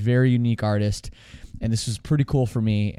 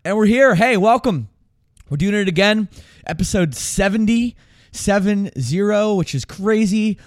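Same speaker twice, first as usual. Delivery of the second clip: excited; 6 semitones higher, 4 dB louder than usual. Real, not acted. Energetic show intro after a sip.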